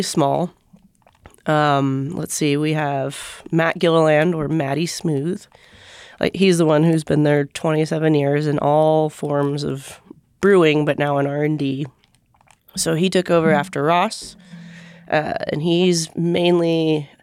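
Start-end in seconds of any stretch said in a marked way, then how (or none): none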